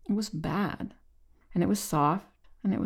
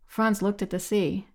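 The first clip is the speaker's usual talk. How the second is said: plain reading voice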